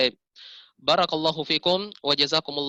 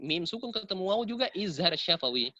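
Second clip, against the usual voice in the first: ordinary delivery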